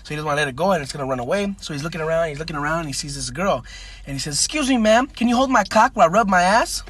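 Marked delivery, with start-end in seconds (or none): 4.4-6.8 higher, louder, imploring question; pleading punchline request. Acted. Rider asks a passing girl, pleading comic request.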